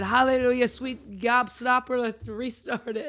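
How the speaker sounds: loud, slow, joyful exclamation; hammed-up rejoicing; mock jubilation. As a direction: celebrate like a revival meeting. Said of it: "I would have said".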